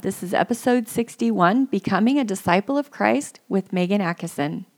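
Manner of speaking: loud, upbeat podcast intro read; announcer voice. Acted. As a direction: announce the show with energy.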